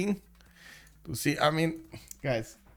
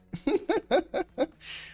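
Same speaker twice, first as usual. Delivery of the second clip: high laugh. Real, not acted. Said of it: "you want the sexy Latin lover voice".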